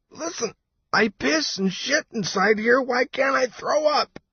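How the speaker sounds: very high, distressed complaint; high-pitched, fed-up outburst; exasperated protest. A narrator's reading. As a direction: protest shrilly while feeling sick. Nauseous Nico protests in his helmet.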